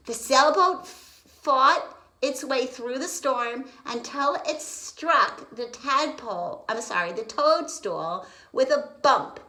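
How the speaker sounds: usual speaking voice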